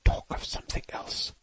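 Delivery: tense hissed whisper; whisper through teeth. A narrator's reading. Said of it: "whispering through her teeth".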